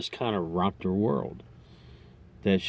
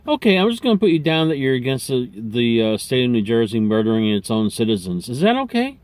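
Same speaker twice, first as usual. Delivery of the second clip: higher, louder and fast, rushing the leading question; mock pollster slipping in a loaded question, higher and louder; pushy pollster; 4 semitones higher, 6 dB louder than usual. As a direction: steamroll him with a loaded question, voice climbing at the end. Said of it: "She goes".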